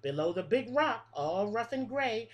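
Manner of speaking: lilting picture-book read-aloud, each line rising then falling; reading to children. Acted. Teacher reading a bug rhyme book to her class, sing-song. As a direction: read the rhyme like story time for little kids.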